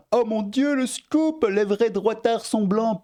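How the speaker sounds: fausse surprise très aiguë, moqueuse; mock shock. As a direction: squeal it out in mock amazement. Moqueur face au tweet. (surprise moqueuse, voix très aiguë)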